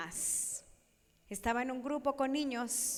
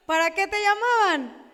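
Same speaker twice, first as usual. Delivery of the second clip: louder, higher pointed question; emphatic challenge; 8 semitones higher, 10 dB louder than usual. Real, not acted. Speaker pressing the audience about childhood scolding, louder.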